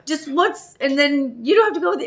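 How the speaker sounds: high, loud, near-tearful begging; cling and beg, voice breaking; clingy pleading. Retold or imitated. Codependent self begging her sponsor, high tearful pleading. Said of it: "my codependency on her is".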